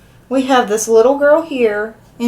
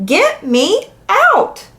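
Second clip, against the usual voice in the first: storybook shout; 10 semitones higher, much slower than usual. A narrator's reading. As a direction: draw out each word as a child's mild yell. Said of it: "in the end I shouted".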